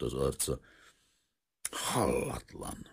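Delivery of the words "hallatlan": slow, hushed indignation; indignant. A narrator's reading. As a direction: draw the word out in contained outrage. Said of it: "mondta indulatosan". (indulatosan, visszafojtva)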